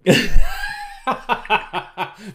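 high, loud burst of laughter. Real, not acted. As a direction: crack up laughing. Laughing at banana joke.

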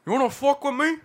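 high, loud mock Scarface gangster accent; hammed-up Scarface line with a laugh; doing Scarface as an accent pick; Scarface impression. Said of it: "What about, like, Scarface?".

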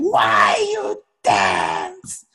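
slow, stretched-out mock shout; imitated yelling. Teacher repeats himself to silent dancers, imitated yelling. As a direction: drag out each word as a hotheaded teacher. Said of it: "He just kept yelling".

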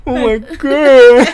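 very high, loud, slow laughing mock gasp; imitating girlfriend laughing. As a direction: drag it out high through laughter. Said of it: "She's like".

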